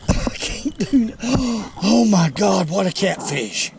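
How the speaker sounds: high, gasping, drawn-out exclamation; breath caught mid-struggle, then loud awe; real astonishment. Real, not acted. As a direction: gasp and cry out in amazement. Straining with a huge catfish.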